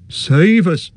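high, loud cry of fear; frightened cry. A narrator's reading. Innkeeper hears of Mordor, frightened high cry. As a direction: cry out in alarm. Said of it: "cried Mister Butterbur".

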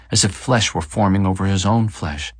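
ordinary delivery

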